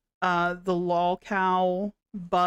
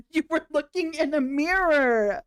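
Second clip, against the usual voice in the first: laughing; 7 semitones higher than usual. Real, not acted. Laughing at the clip.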